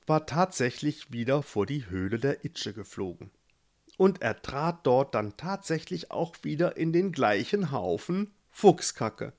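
plain reading voice

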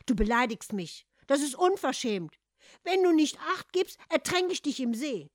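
loud, high indignant threat; offended. Acted. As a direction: bristle and threaten. Hans takes offense.

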